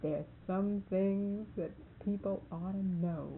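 slow, grave imitation; measured mock warning, word by word; real serious. Marvin looks at her to warn her, real serious. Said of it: "gets real serious and looks at me".

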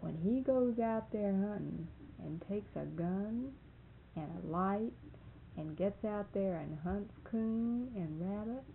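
slow, solemn imitation with pauses; serious. Marvin warns her about hunting at night, dead serious. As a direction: lay out the charge gravely, phrase by phrase. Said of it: "Serious, and he says".

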